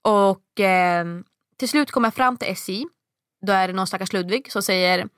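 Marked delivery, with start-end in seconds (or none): none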